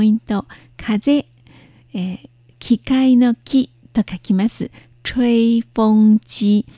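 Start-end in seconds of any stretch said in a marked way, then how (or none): 5.0-6.7 吹風機 drawn out, one syllable at a time; model pronunciation. Shown as a demonstration. Teacher showing learners a three-character word, slow model pronunciation. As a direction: say 吹風機 very slowly, each syllable held. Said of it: "風の発音は".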